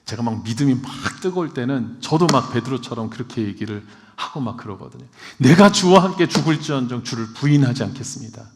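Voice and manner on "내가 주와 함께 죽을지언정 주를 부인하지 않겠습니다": loud, self-assured vow; cocky loud promise of loyalty; confident boast. Retold or imitated. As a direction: declare it proudly, full of zeal. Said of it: "아주 자신 있게 저도 뭐 얘기하거든요".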